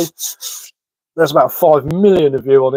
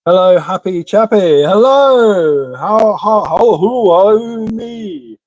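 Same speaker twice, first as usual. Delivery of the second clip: cheery greeting; 5 semitones higher, 4 dB louder, slower than usual. Acted.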